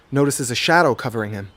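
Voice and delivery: ordinary delivery, nothing marked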